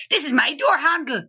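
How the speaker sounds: high-pitched, exaggerated Northern Irish accent; be a stubborn Northern Irish accent claiming its territory. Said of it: "That accent is stuck to that".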